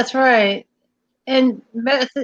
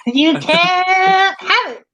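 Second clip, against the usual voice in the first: slow, higher sing-song taunt; teasing taunt; 7 semitones higher, much slower than usual. Pretend. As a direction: drag it out like a bratty kid.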